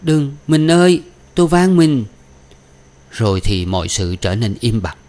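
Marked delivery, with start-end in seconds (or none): 0.0-2.0 raised, imploring woman's voice; alarmed pleading. A narrator's reading. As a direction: beg your lover to stop. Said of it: "có tiếng Đào dãy lên".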